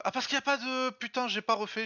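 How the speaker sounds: high, loud dismayed realization; sudden realization. Real, not acted. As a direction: blurt out the mistake. Realizes a mistake.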